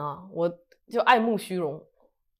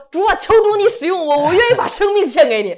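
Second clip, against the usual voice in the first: retold fervent prayer; 8 semitones higher, 9 dB louder than usual. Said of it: "结果一祷告又说".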